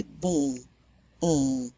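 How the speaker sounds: "bon" and "on" through the nose with the soft palate dropped; nasal «on» with rounded lips; French nasal on. Shown as a demonstration. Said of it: "drop the soft palate, and you can say".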